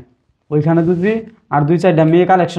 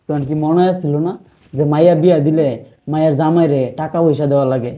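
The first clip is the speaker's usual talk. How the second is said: normal talking voice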